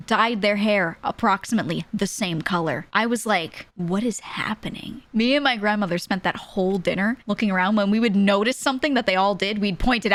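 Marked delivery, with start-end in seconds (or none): 3.7-5.0 slow, whispered disbelief; stunned whisper. Retold or imitated. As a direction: whisper it, slowly, bewildered. Baffled at identical family.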